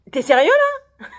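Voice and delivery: high, loud outraged question; can't believe what she's hearing; exasperated disbelief. Retold or imitated. Confronting the lazy coworker, high and outraged. Coworker admits he skipped work.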